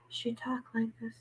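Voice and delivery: imitating a shy, whispery talker; very quiet. Host shows how shy Chrissy first talked, whispered. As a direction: whisper it like someone too shy to speak up. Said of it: "she was just talking like this".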